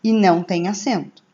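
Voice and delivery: usual speaking voice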